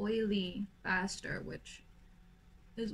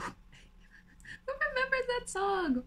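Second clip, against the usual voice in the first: playful; 14 semitones higher, much slower than usual. Pretend. Carries on singing after song line.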